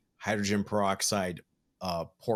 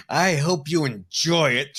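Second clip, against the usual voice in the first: mock-gracious, slow and louder, jaw clenched; through gritted teeth; 6 semitones higher, 6 dB louder, slower than usual. Co-host teasing a friend who lost an auction, through gritted teeth. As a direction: squeeze it out slowly, higher, teeth gritted. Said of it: "through gritted teeth".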